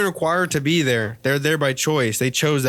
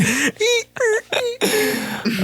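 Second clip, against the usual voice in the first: a long, much higher squealing laugh. Real, not acted. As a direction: let a high laugh ring out. Laughing at the joke.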